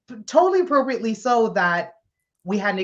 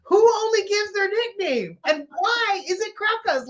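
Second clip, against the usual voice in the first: incredulous; 13 semitones higher than usual. Retold or imitated. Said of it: "I was like".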